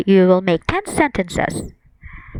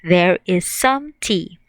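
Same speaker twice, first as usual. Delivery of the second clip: slow model sentences; slower than usual. Shown as a demonstration. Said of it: "Or we can say".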